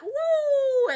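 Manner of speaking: high, bright whoop of joy; excited woo. Real, not acted. Creator celebrating her follower milestone with a high cheer.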